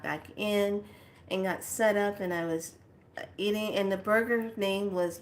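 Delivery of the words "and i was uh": ordinary talk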